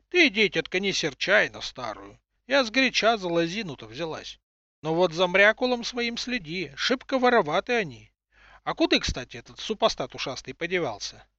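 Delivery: put-on high cracked old crone voice, calling; old woman voice. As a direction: call out like a grumbling grandma. Old woman talks to boy.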